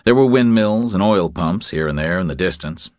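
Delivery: usual speaking voice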